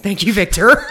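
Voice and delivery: laughing. Real, not acted. Laughing mock thanks to cohost.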